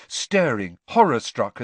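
ordinary delivery, nothing marked

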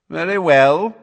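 high, loud, lofty assent; aloof. Acted. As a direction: concede it from on high. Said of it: "he said aloofly".

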